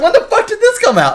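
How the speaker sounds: very high, loud incredulous question; reliving a startled discovery; shocked disbelief. Retold or imitated. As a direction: squawk the name in astonishment.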